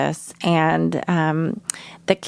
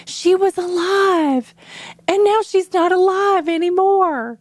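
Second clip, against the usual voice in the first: voicing the children; 13 semitones higher than usual. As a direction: mimic a sad small child. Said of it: "the children were saying".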